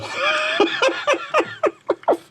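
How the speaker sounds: a long high cackle; high cackling laugh. Real, not acted. Laughing at his own Scottish joke, cackling. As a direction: cackle at your own punchline.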